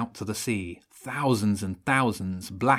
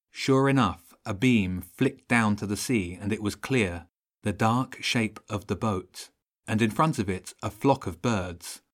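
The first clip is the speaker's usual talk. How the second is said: ordinary delivery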